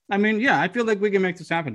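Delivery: talking through a mouthful of fries; words round a bite of food; mouth full. Real, not acted. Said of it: "I'm sorry, I'm eating fries".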